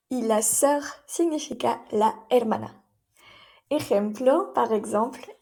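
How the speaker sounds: usual speaking voice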